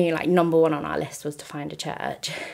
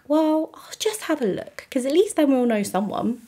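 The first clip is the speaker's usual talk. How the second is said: a lifted, higher hopeful musing; higher, lightly hopeful inner voice; hopeful thought; 9 semitones higher than usual. Retold or imitated. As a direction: voice your past self clinging to a small hope. Her own hopeful past thought.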